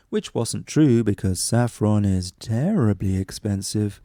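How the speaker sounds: plain reading voice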